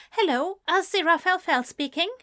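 quick, high and flustered; flustered phone greeting. Acted. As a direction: answer the phone all in a fluster.

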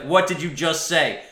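loud, high comic challenge; mock outrage. Acted quarrel over y'all.

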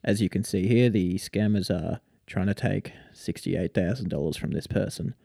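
low, quiet British deadpan narration; soft, dull British monotone. Retold or imitated. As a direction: imitate a dry, unbothered British YouTuber. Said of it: "dull, monotone British voice".

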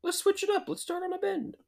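high, mocking voice of race planners; squeaky parody of a clueless planner; mocking the organizers. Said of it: "They're like".

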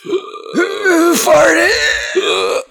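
usual speaking voice

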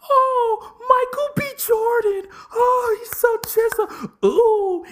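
very high, breathy swoon; woman gushing over a movie star; wife melting over Michael B. Jordan; swooning fan. Pretend. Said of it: "sit up there and say".

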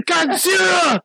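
high, loud mock monster alarm; imitated drunk shout. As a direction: holler it like a drunk heckler. Imitating drunk men yelling at friend.

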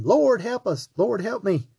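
high, mock pleading cry for help; a touch of a desperate plea. People crying out to the Lord, in a mild mock plea. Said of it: "we cry and scream".

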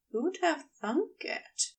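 plain reading voice

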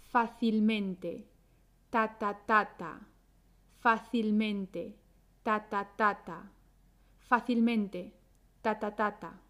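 "Fácilmente" measured as FA-cil-MEN-te, echoed TA-ta-TA-ta; two strong syllables. Shown as a demonstration. Teacher drilling double stress for learners, "Fácilmente" as FA-cil-MEN-te. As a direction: stress both FA and MEN in "Fácilmente", then tap the same rhythm on ta. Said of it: "tienen dos sílabas fuertes".

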